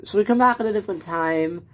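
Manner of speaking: ordinary talk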